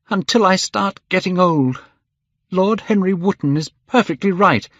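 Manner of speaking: high, agitated reproach; angry outburst. A narrator's reading. Dorian red-faced at the painter, agitated and angry. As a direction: pour out the hurt, pitched high. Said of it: "he seemed quite angry".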